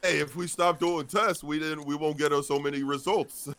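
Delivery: a hint of a clueless politician; mock governor. Governor explaining away case numbers, high mock dumb voice. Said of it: "it's basically like".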